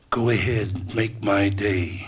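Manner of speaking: slow, drawn-out movie tough-guy line; Clint Eastwood. Acted. Host challenging the absent side, slow tough-guy catchphrase. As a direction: stretch it out as a borrowed catchphrase. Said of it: "Just stole that from Clint Eastwood".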